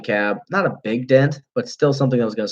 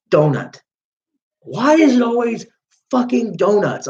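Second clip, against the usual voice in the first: exasperated; 10 semitones higher than usual. Retold or imitated. Cop annoyed at donut offer.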